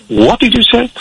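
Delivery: high-pitched quoted retort, tossed back; sharp retort. Retold or imitated. The girl retorting to the teachers, high and sharp.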